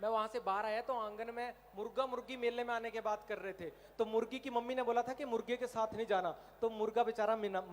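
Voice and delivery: usual speaking voice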